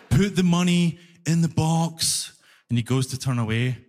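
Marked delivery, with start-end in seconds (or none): 0.1-2.3 word-by-word, slowed right down; slow, exasperated instruction. Acted. Fare collector instructs the clueless foreigner, slow and exasperated. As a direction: spell it out with strained patience.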